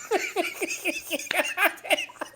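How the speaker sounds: high, helpless giggling; real laughing fit. Real, not acted. Host retelling a castmate's threat to viewers, helpless laughter. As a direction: crack up at your own story.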